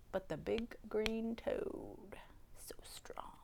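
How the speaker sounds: slow near-whisper of wonder; hushed reveal. A narrator's reading. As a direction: drop to a soft, slow whisper. Hushed reveal of the unlikely helper.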